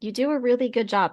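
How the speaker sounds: usual speaking voice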